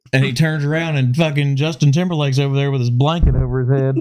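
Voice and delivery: ordinary talk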